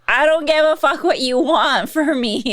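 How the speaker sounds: fed-up, played for laughs; mock defiant daughter. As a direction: push back at mom, half joking. Daughter talking back to her mom.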